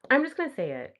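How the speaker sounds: fed up, just saying it outright; exasperated outburst. Real, not acted. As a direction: blurt it out in exasperation. Frustrated outburst before a fresh start.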